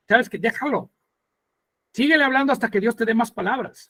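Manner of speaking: ordinary delivery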